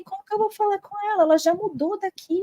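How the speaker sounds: high, hurried mock objection; retold protest. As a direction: rush out a bewildered question. Retells her protest to mother.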